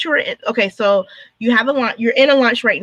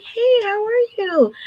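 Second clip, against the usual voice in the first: much higher, slow, sugary hello; mock sweet greeting; 11 semitones higher, slower than usual. Designer greeting a guilty client, slow and sugary high. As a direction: greet them extra sweetly, suspicious.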